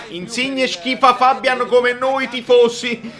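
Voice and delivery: ordinary talk, nothing marked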